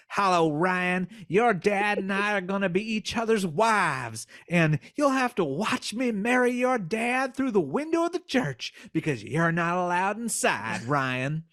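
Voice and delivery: high Southern twang; sly silky Southern fox. Acted. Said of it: "twang for the voice of the fox".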